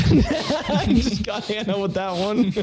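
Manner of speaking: high, slow laughing words. Real, not acted. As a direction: talk through a laugh. Laughing at own joke.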